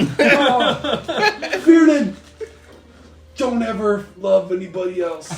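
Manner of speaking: melodramatic farewell. Acted. Dying actor pleading with Runen, melodramatic wail. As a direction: ham up the dying lover's plea.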